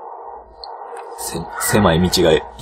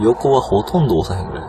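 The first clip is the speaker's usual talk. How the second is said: ordinary talk, nothing marked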